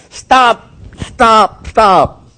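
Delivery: loud, high repeated stop; urgent stop. A narrator's reading. Samuel halting Saul's excuses with a loud stop. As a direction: cut him off sharply, thrice.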